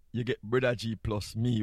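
ordinary delivery

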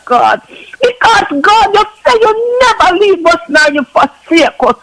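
impassioned crying prayer. Real, not acted. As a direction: cry out to God, voice breaking with fervour.

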